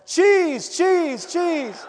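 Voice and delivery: high, loud desperate calling; screaming. Retold or imitated. Daughter chasing her runaway dog down the street, screaming. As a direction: scream it at the top of your lungs. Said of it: "screaming at the top of her lungs".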